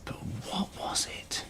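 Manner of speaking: soft, secretive whisper; curious whisper. A narrator's reading. Narrator drawing children into the mystery, hushed whisper.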